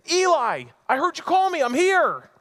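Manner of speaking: put-on high, loud eager little boy calling out; a small boy waking his mentor at night, high and eager; child Samuel. Young Samuel calls to Eli at night, high and eager.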